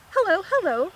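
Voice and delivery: high-pitched calling hello; excited shout. A narrator's reading. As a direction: call out eagerly as the dog. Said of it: "she shouts".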